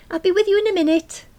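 high, loud call to a visitor; shouting. A narrator's reading. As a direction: call out at full pitch. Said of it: "I shouted".

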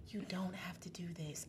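hushed, unvoiced whisper; whispered inner voice. Voice interrupting her during sex, whispered. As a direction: whisper it softly, like a small voice. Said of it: "I just hear this voice".

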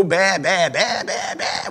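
a hint of a bleating sheep; mock sheep bleat. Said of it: "poor thinking sheep still go".

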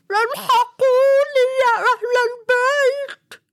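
very high, slow, terrified cry; panicked call for help. Acted. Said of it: "فانزعج السايس وقال".

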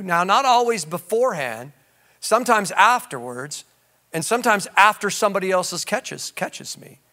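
ordinary delivery

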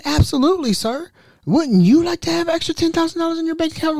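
high, fake-eager echo; high mocking voice. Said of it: "I said".

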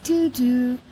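mock gate announcement voice; lightly put-on PA announcer; airport announcement. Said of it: "like, airport announcements".

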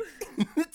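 high burst of giggling; a high, loud giggle bursting out. Real, not acted. Friend cracks up at her own Stormbreaker joke, giggling high and loud.

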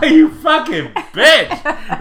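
high, loud laughing curse, slow; laughing insult. Retold or imitated. Storyteller mocking the careless mother while laughing. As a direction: spit it out through laughter.

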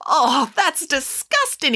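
drawn-out, high-pitched revulsion; disgusted exclamation. A narrator's reading. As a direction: exclaim like grossed-out children, stretching it. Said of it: "exclaimed the children".

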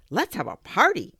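loud, excited call; hooted. A narrator's reading. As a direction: whoop it out in delight. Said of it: "Owl hooted".

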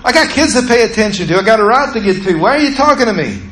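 fast, high-pitched exasperated retort. Retold or imitated. Driver to the backseat critic while driving, fast and mad. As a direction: fire back excuses in a rush. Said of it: "I'm getting more mad".

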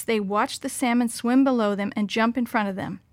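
ordinary delivery, nothing marked

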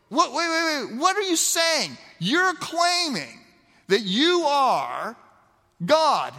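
high, incredulous mock outrage; shocked disbelief. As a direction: act out the priests' stunned protest. Outraged priests question Jesus.

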